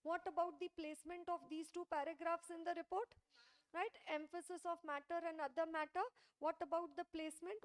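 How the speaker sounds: usual speaking voice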